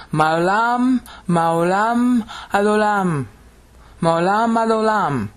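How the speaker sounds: high, drawn-out proclamation; thundering Hebrew. Shown as a demonstration. Teacher repeating the Sabbath phrase for students, thundering. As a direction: intone it like a call to worship. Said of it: "the thundering Hebrew".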